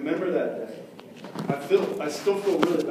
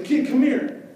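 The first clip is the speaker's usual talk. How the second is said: higher, louder bully call; 8 semitones higher, 6 dB louder, slower than usual. Retold or imitated. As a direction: summon the kid sharply. Bully calling kid.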